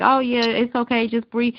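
high-pitched mimicry of glib comforters; dismissive reassurance. Mimicking people who belittle anxiety, glibly reassuring. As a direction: imitate people breezily waving it away.

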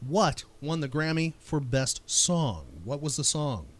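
ordinary talk, nothing marked

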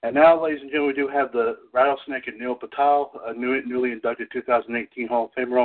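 ordinary talk

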